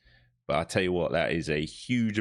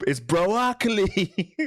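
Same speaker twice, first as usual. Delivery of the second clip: high, very slow drawn-out broccoli, louder; Chris D'Elia bit; 11 semitones higher, 5 dB louder, much slower than usual. Acted. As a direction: stretch every syllable of broccoli. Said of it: "I've been watching too much Chris D'Elia".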